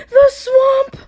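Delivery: a high, loud yelp of fright; frightened cry. Acted. Kids seeing Miss Swamp arrive, loud high yelp. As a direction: shriek it like scared kids. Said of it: "cried the kids".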